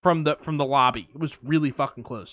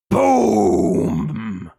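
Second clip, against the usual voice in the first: imitated boom; 4 semitones higher, 5 dB louder than usual. Telling a friend about the blast, loud boom. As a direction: shout boom like an explosion. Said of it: "I hear".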